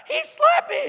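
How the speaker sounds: put-on high, loud whiny child wail, slow; mock crying child telling on another kid. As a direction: wail it like a sobbing little kid. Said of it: "some kid comes up to you crying".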